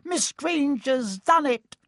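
high, slow squeaky delighted cry; excited Flitwick cry. Acted. Flitwick praising Hermione.

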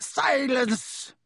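cold shout. A narrator's reading. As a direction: cut him off hard. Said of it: "said Snape coldly".

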